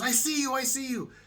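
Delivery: imitating the uncle calling back across the crowd. Uncle calling from outside bus.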